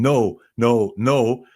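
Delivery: hammed-up yell of no; mock shout. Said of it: "Then he shouts again".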